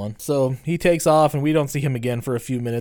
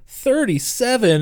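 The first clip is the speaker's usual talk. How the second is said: drawn-out, high call from off screen; Randall; 10 semitones higher, 3 dB louder, slower than usual. Retold or imitated. Randall calls from off screen to correct Dante, drawn-out call. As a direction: call it out slowly, much higher. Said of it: "Randall immediately goes off screen".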